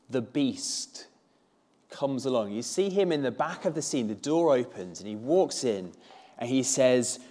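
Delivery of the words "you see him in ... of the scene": normal talking voice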